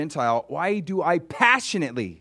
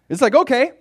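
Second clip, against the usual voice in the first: exasperated okay; 12 semitones higher, 8 dB louder, faster than usual. Real, not acted. Exasperated aside.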